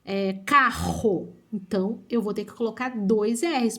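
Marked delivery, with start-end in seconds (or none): 0.5-1.4 CARRO split slowly, RR as a strong H; strong double R. Shown as a demonstration. Teacher showing double R mid-word, strong R in "carro". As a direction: stretch "carro" with a hard aspirated RR. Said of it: "o som tiver bem f".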